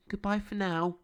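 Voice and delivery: put-on a girl's sweet farewell pitched high; little girl waving goodbye to a rabbit; Nina's high voice. A narrator's reading. Nina bids rabbit goodbye.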